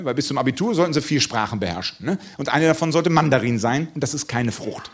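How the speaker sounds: ordinary talk, nothing marked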